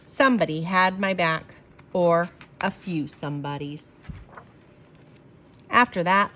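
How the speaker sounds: usual speaking voice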